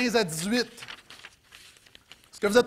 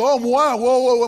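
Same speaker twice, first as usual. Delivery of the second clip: loud, blustering imitation of a braggart; hammed-up bluster; mock boasting; 3 semitones higher, 8 dB louder than usual. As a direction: burst in as an overconfident big talker. Mimicking people boasting about themselves.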